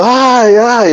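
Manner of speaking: high, frightened little ay ay; mock frightened cry. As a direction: squeal like a scared seminarian. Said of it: "uno se asustó". (quejido asustado muy agudo)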